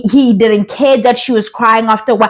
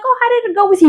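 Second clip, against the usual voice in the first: high-pitched feminine question; imitating her speech; 11 semitones higher, much faster than usual. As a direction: mimic her asking, pitched way up. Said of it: "she's like".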